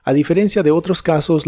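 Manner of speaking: normal talking voice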